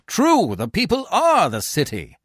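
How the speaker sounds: high, emphatic crowd assent; loud agreement. A narrator's reading. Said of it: "The citizens all agree".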